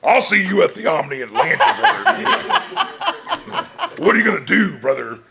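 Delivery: loud hammed-up wrestler promo, shouting brother; Hulk Hogan impression. Actor shows the crowd his Hulk Hogan voice. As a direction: cut a big eighties wrestling promo. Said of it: "Hulk Hogan would be like".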